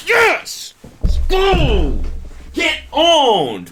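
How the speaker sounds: real gleeful shouting; triumphant cheer. Real, not acted. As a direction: cheer like your team just scored. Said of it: "she destroyed him with that message".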